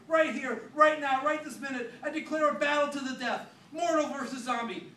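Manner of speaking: grand declaration. Acted. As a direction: declare war like an epic hero.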